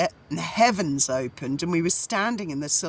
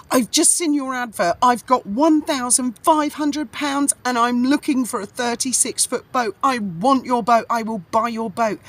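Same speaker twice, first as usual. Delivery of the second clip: higher and a bit louder, keen and pushing; eager message; 6 semitones higher, 4 dB louder than usual. Retold or imitated. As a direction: sell yourself as the buyer, urgently.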